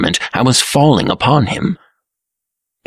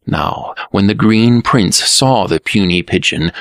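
ordinary delivery

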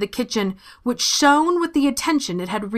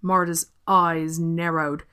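plain reading voice

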